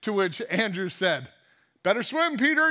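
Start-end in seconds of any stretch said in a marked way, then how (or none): 1.8-2.7 mocking jab. Andrew mocks sinking Peter.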